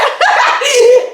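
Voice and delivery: high laugh. Real, not acted. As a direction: let the laugh burst out high. Laughs at own blunder.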